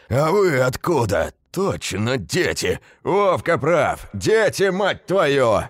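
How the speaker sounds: high, loud outraged builder's shout. A narrator's reading. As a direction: bellow it at the kids caught inside. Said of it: "громко кричал кому-то в проём дядька".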